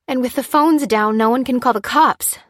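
high, loud alarmed gasp. A narrator's reading. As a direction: blurt it out in shock. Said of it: "gasped Lucy".

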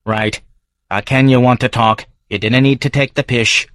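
gruff Edinburgh local sizing up a stranger; Davy's Scots brogue; Scottish barfly. Acted. Davy irritated.

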